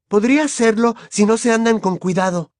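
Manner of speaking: high-pitched, indignant warning; angry girl. A narrator's reading. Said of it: "intervino una enojada Hermione". (chica enojada, voz muy aguda)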